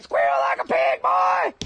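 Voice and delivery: loud, high-pitched jeer; hammed-up taunting yell. Streamer acting out Deliverance scene, taunting shout. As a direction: shout it, leaping up on the last word.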